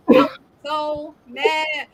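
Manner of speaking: ordinary talk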